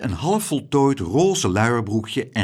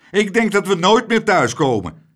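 higher, louder moaning lament; wailing; 10 semitones higher, 9 dB louder than usual. Acted. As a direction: moan it out in despair. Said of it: "jammerde meneer Tump".

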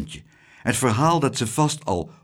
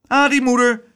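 higher, louder happy greeting; happy shout; 10 semitones higher, 6 dB louder than usual. Acted. Boy reunited with his mother, happy shout. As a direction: greet her with glee.

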